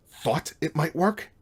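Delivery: high, slow, loud incredulous growl; outraged, stretched-out echo. A narrator's reading. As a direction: throw the words back angrily, drawn out. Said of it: "growled at him".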